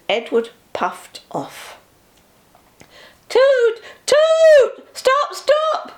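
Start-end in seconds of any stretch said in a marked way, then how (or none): 3.3-5.9 high, loud wailing toots. A narrator's reading. Bertie the bus wailing at Edward to stop, high and loud. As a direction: wail after the departing train. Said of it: "wailed Bertie".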